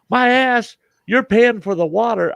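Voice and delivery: the uncle protesting the bill; gruff scolding. Retold or imitated.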